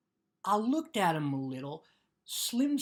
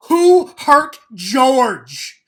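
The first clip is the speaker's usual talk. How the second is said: loud, slow, menacing demand; angry shout; 4 semitones higher, 18 dB louder, much slower than usual. Acted. Lenny rising at Crooks over George, furious shout. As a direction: roar it slowly, threatening.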